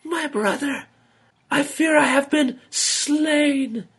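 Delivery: very high, slow, faint last words; dying snake sister. A narrator's reading. Dying sister speaks to her brother, high faint last words. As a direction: fade away with her final breath.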